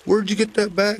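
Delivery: high, loud, startled question; jolted at the sight of the bag; sudden sharp pitch jump on where'd. Acted. She spots her aunt's bag.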